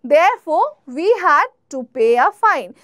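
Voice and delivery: very slow, clear classroom read; slow, careful teacher example; slow example sentence. Shown as a demonstration. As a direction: spell it out slowly for learners. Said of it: "আর কি আছে?".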